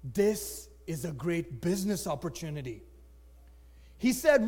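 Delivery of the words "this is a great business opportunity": low, soft inner voice; hushed acted aside; thinking to himself. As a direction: say it privately, as a dawning scheme. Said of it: "he thought to himself".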